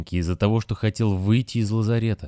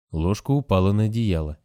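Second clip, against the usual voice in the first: ordinary delivery, nothing marked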